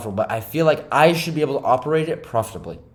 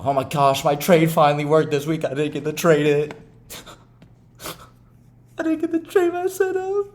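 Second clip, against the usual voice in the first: mocking whiny trader; 3 semitones higher than usual. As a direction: whine like a trader who missed out. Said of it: "where I would get so much fomo".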